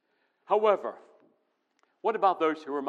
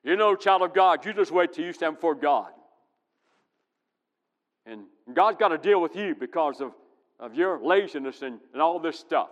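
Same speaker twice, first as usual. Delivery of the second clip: loud, scolding hellfire preacher voice; hammed-up angry pulpit rant; mock ranting preacher; 3 semitones higher, 8 dB louder than usual. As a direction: mimic the preacher who threatens sinners. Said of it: "preaching that goes like this".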